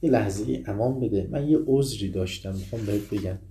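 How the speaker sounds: ordinary delivery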